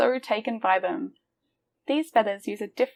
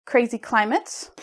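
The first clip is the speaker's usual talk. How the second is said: ordinary delivery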